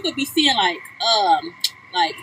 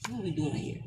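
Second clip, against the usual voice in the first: breathy unvoiced whisper; 6 semitones lower, 19 dB quieter than usual. Real, not acted. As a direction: whisper it in disbelief. Whispered aside.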